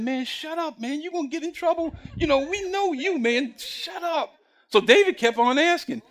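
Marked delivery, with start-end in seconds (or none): 0.0-4.4 higher, hushed scolding; angry brothers. Retold or imitated. Big brothers hushing David angrily, hushed scolding. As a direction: shush him hard, half-whispered. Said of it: "David's brothers got angry and said".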